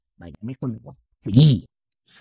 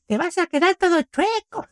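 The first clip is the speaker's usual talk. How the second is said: much higher, slow mock granny warning; nagging grandma. Grandson imitating grandma scolding his posture, high and slow. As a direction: drawl a granny's fussy warning. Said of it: "Esa me la decía más mi abuelita".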